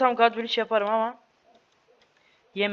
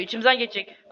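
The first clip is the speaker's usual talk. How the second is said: ordinary talk, nothing marked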